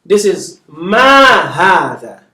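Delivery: ma hadha said slowly and carefully; ma hadha, each word clear; slow model phrase. Shown as a demonstration. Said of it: "So remember now".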